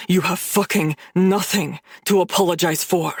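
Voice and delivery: low rasp with a dark edge. Acted. Gavin rasps to her that she owes no apology. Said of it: "Gavin rasps, with a dark edge".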